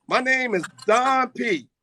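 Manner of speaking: slow, deliberate name drop; slow boast. Acted. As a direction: drag it out proudly. Boastful self-introduction.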